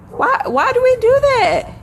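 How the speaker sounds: exasperated why. Acted. Preacher incredulous that we give power away, high exasperated. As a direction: throw the question up high in disbelief. Exasperated rhetorical question to audience.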